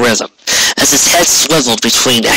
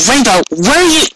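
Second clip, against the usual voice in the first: high worried call; 13 semitones higher than usual. Acted. Twilight calling after Rainbow as she flies off, high worried call. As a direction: call after her into the night.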